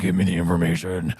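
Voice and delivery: low, lightly voiced Batman demand; a mild mock Batman growl; gruff Batman. Retelling the deleted scene, mock Batman voice. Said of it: "he's like".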